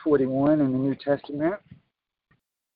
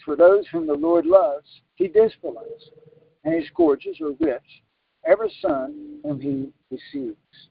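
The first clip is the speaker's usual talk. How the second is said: high, slow, emphatic verse reading; scripture reading; 12 semitones higher, 4 dB louder, slower than usual. Real, not acted. Preacher reads verse six to listeners, slow scripture reading. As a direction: proclaim the verse slowly, pitched up.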